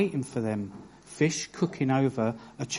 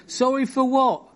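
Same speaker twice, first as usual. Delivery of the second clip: higher, pressing mock-mum challenge; imitating demanding mum; 10 semitones higher, 5 dB louder than usual. Mum pressing her son after a careless apology, mock demanding. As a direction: play the mother who won't accept a lazy apology. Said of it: "their mum said".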